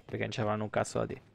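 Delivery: usual speaking voice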